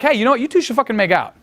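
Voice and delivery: blunt mock suggestion. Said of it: "If you're just like".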